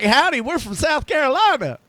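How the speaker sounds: put-on high, hammy Southern accent. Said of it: "That was your South Carolina voice there".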